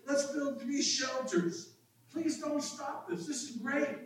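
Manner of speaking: high-pitched enthusiastic proposal; excited Peter. Acted. Preacher as Peter answering the glorious scene, excited. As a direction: plead eagerly to keep it going. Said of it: "in his excitement".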